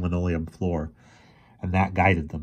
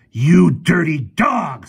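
high, drawn-out furious screech; slow high screech of rage; 9 semitones higher, 8 dB louder, much slower than usual. A narrator's reading. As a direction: screech it at him in outrage. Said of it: "screeched the professor".

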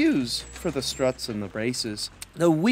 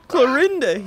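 calling out; 8 semitones higher, 5 dB louder than usual. Acted. Hop calls the cow.

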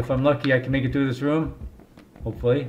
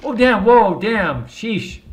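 startled; 7 semitones higher, 6 dB louder, much slower than usual. Real, not acted. Hit in game.

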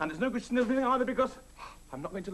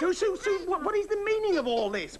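exasperated protest; 9 semitones higher than usual. Real, not acted. Piles of packing listed at him.

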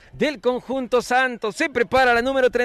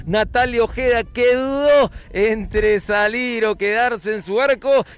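ordinary talk, nothing marked

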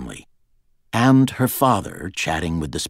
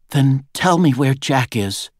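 higher, louder, slow-paced demand; 8 semitones higher, 5 dB louder than usual. A narrator's reading. Girl to her guards about missing Jack, demanding. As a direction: press for an answer, pitched up and loud. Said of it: "she demanded".

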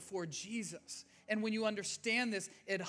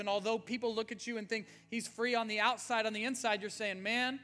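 rising full-force preaching; 4 semitones higher, 6 dB louder than usual. Acted. Pastor preaching to his church, building to an excited cry.

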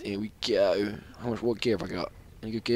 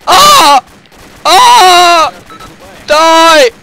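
much higher, much louder screams; frantic yelling; 15 dB louder, much slower than usual. Real, not acted. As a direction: yell in raw panic at the screen. Firefight, game lags.

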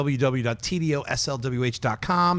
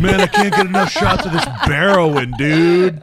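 mock junkie; 3 semitones higher, 6 dB louder than usual. Junkie bear joke.

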